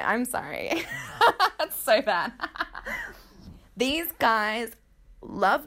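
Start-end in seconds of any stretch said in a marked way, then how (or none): none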